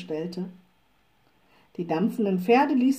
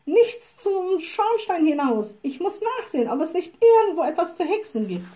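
high, lively and raring to go; eager, enterprising; 7 semitones higher than usual. A narrator's reading. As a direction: sound keen for a little adventure. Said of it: "rief sie unternehmungslustig". (unternehmungslustig, mit hoher Stimme)

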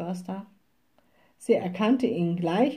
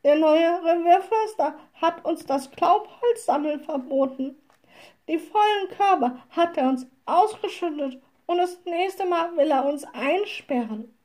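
high, plaintive complaint; lightly acted tearful women; sobbing; 7 semitones higher than usual. A narrator's reading. Women complain about the new forester, mildly tearful. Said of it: "schluchzten die Weiber".

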